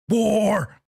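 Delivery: high, loud mock yell of war; mock shout. Host mocking the father's race war line with a loud shout. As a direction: bellow it like a parody.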